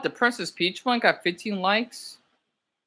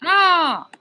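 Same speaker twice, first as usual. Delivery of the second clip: startled shriek; 9 semitones higher, 7 dB louder than usual. Real, not acted. Sudden cry again.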